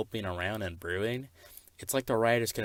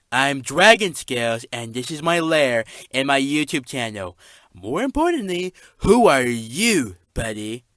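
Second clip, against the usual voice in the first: higher, louder boastful villain voice; loud boastful character; 4 semitones higher, 11 dB louder than usual. Acted. Dragon character boasting to an intruder, high and loud. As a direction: puff up and announce yourself. Character introduces himself loudly.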